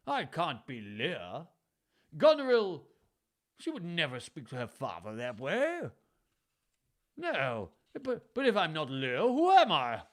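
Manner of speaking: mock his daughter, then cry out high and loud; a hint of a wounded old king; sarcastic Lear. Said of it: "concealed his humiliation with sarcasm".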